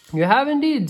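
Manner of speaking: bright, keen animal voice; eager Mister Beaver. A narrator's reading. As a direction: chime in as an excited beaver.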